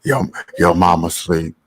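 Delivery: low, slow mock late-night caller; phone voice.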